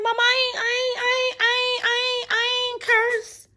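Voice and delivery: very high, stuttering denial; pleading child. Retold or imitated. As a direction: deny it in a tiny whiny voice. Child denies cursing to mama.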